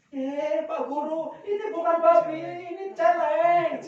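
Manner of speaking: sing-song mock indignation, stretching the last word; indignant villager's correction. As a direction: imitate a villager setting the teacher straight.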